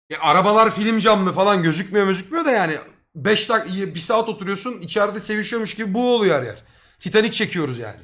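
ordinary talk

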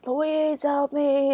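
high, held-back cry for help; mock scream. Michael calling Louise for help, high mock scream. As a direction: imitate a distant scream without the volume. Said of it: "and he screams".

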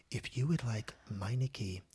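soft, slow, wet-mouthed announcement read; wet announcer voice. Retold or imitated. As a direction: read the muffler ad in a moist, hushed radio voice. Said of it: "my N P R wet voice".

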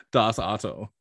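slow, drawn-out German catchphrase; German accent. Pretend. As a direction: stretch it out in a mock German accent. Repeats his German catchphrase.

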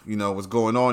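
ordinary talk

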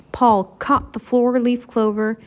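ordinary delivery, nothing marked

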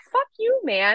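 a high yelped Fuck you, then plain man; mock defiance from your own body; defiant nervous system telling you off. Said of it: "and is like".

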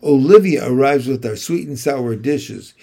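ordinary delivery

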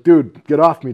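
loud imitated protest from a held man; retold loud protest. Held brawler to his captor, loud protest. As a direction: push it out loud, mock indignant.

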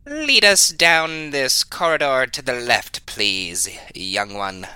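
lightly in character, politely directing a companion; lightly voiced party guide. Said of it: "Willem's gonna point to the left and say".

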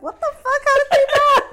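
high laughing shout; mock outrage. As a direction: imitate mom yelling in disbelief, high-pitched. Voices mom's outraged reaction.

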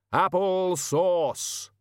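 very slow, high drawn-out cry; ponderous, high holler; commanding call. A narrator's reading. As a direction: stretch both words out as a command to animals. Figure shouts command to pigs.

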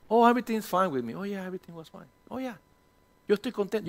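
breezy, falsely cheerful reassurance; faking fine. Retold or imitated. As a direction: pretend all is well, lightly. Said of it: "hacemos creer a la gente que we are fine". (alegría fingida, a la ligera)

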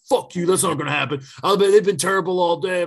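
a hammed-up angry bettor yelling back; mock angry drunk fan. Drunk fan yelling at the guy who doubts Texas, mock anger. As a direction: fire it back fast like a drunk sore fan.

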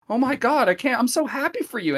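high, mock-gushing voice; gushing Louis. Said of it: "He's like".

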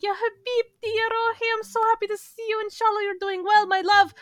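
high, gushing auntie voice. Retold or imitated. Aunt greeting her niece at the wedding, gushing and high. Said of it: "she's like".

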